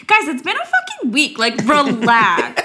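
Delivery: high, exasperated retort. Retold or imitated. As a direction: tell them to chill, fed up.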